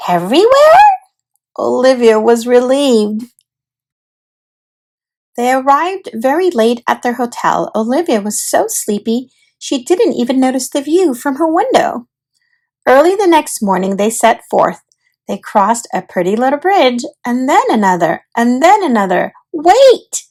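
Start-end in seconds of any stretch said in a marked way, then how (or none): 0.1-0.9 thrilled, much higher, louder everywhere; excited question. Acted. Olivia delighted about ice cream everywhere, excited squeal. 19.5-20.2 high, loud call of alarm; cried out. Acted. Olivia stopping her family on the bridge, loud cry. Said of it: "cried Olivia".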